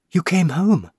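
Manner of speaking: usual speaking voice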